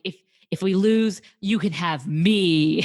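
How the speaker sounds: loud, slow, weighty promise; dramatic vow. Retold or imitated. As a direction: lay it down slow like a big bet. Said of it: "then he's like".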